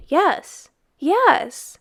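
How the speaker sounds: a rush of eager agreement; eager cry. Acted. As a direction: boys shouting their pledge. Boys eagerly pledging their year.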